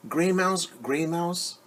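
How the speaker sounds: ordinary delivery, nothing marked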